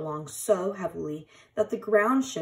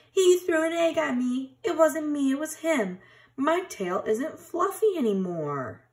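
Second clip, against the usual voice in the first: put-on squirrel kids bickering; 7 semitones higher than usual. A narrator's reading.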